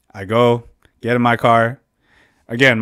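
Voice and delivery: usual speaking voice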